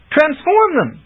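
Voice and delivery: urgent high shout; crying out. Acted. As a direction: call out in alarm. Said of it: "Bink cried".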